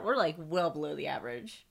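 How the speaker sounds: ordinary talk, nothing marked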